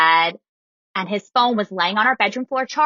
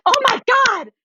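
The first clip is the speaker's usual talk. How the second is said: high-pitched retold gasp of excitement; thrilled shock; 4 dB louder than usual. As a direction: imitate friends squealing at big news. Coworkers' reaction to the secret, acted.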